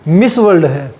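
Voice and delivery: normal talking voice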